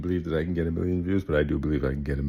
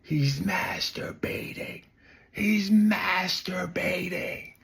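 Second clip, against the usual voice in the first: mock Karen scream; 13 semitones higher, slower than usual. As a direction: ham up an outraged Karen calling out the crime. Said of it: "and she's screaming".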